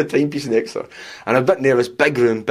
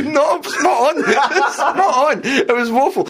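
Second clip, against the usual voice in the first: laughing high through the words; cracking up while agreeing; slower than usual. Real, not acted. Laughing at his own bad review.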